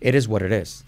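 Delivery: "It is what it is" like "ered ess war ered ess"; linked, e-coloured "It is what it is"; every I as E. Shown as a demonstration. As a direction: run it together with open e vowels and tapped t's in "It is what it is". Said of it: "Todo es".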